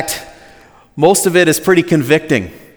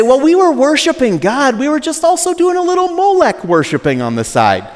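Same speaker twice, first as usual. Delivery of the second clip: much higher, sheepish hammed-up excuse; mock excuse; 12 semitones higher than usual. Preacher mocking Israelites excusing idol worship, much higher and hammy. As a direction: play a shrugging sinner making excuses. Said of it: "They don't say".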